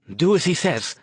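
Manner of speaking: high, tense, nervous urging; steady but nervous plea. Acted. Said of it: "a lot less quavery than usual, though still nervous".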